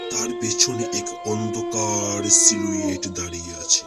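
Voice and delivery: plain reading voice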